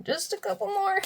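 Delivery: high sing-song repeat; playful high-pitched singsong. Real, not acted.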